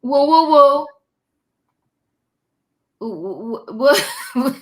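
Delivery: stunned, laughing through the whoas; a loud whoa, then laugh the rest out; real shock breaking into laughter. Real, not acted. Reacting to the clip.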